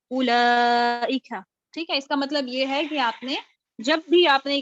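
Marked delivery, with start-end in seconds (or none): 0.1-1.3 very slow, loud اولئک, the waw not lengthened; slow Quran recitation. Shown as a demonstration. Teacher reciting the common word اولئک slowly for students. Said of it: "بلکہ سب کیا پڑھتے ہیں؟".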